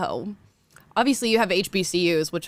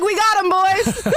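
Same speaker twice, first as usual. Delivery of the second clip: high, drawn-out, louder call with laughter; mock triumphant call; 11 semitones higher, 5 dB louder, slower than usual. Telling the boys they caught one, mock triumph. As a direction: announce the catch to the squad. Acted catching of the impostor.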